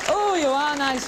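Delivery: slow, high camp tease; teasing. Real, not acted. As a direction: coo it at the crowd. Teasing the audience.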